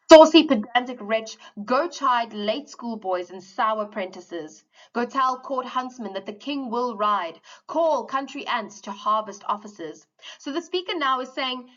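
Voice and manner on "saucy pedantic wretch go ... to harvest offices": loud opening, then slow verse recital; poem reading. Acted. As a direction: declaim the stanza with force. Reads next stanza aloud.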